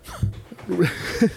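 high rising laugh. Real, not acted. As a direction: burst out laughing. Laughs at SNL joke.

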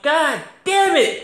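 high, slow groan of frustration; exasperated "God damn it"; frustrated outburst. Retold or imitated. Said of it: "and I'm like".

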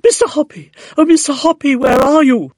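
high, loud, excited calls; shrill excited shouting. A narrator's reading. Neighbour calls Mister Hoppy from below, shrill excited shouts. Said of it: "shrill with excitement".

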